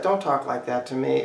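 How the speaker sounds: hushed, understated upset; really upset. Shown as a demonstration. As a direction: play it upset, kept very quiet. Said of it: "or they're really upset".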